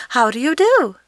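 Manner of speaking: a bright, polite greeting; polite little girl. A narrator's reading. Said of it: "replied Dorothy politely".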